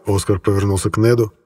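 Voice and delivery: ordinary delivery, nothing marked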